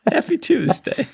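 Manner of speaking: squeaky-high, teasing imitation; mock-chirpy greeting. Co-host echoing the friendly greeting, absurdly high. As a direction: push it way up into falsetto.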